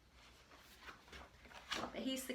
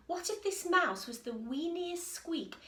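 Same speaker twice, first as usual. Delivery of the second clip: ordinary delivery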